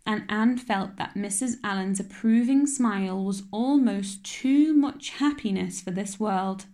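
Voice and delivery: plain reading voice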